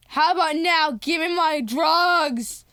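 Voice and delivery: loud, high shouted demand; acted forceful bullying; mock threatening demand. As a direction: shout it like a pretend stickup. Grace threatens with gun.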